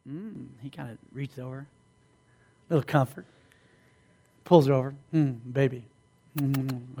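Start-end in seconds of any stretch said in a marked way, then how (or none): 0.1-0.5 very soft, low murmur; hushed mock-romantic hum; amorous boyfriend. Boyfriend making a move on couch. 5.1-7.0 low, soft murmur; deep, hushed sweet-talk; amorous boyfriend. Acted. Couple cuddling on the couch.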